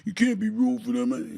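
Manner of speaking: high nagging mock voice; mocking fans who tell him not to root; mocking critics. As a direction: mimic the doubters whining. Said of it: "you got a lot of people out there".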